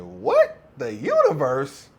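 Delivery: mock disbelief, the universe drawn out slowly; an outraged double take; incredulous what. To the girlfriend thanking the universe, indignant disbelief. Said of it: "I said".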